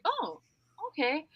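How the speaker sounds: high, soft oh okay; mock realisation. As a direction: act like it all clicks.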